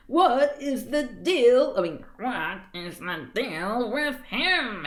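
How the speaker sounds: very slow, drawn-out character drawl; exasperated character voice. A narrator's reading. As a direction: drag out each word in bafflement. Reader voices Rupert in story.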